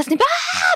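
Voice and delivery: high mock shriek of a screaming actress; imitated scream. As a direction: shriek like she yells at everything. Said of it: "alegría grita". (grito agudo de burla)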